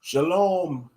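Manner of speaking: ordinary talk, nothing marked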